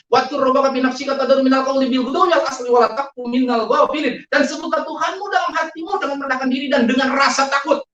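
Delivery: high, loud recitation at an opponent; forceful scripture quoting. Retold or imitated. Man retelling how he quoted scripture at his accuser, emphatic.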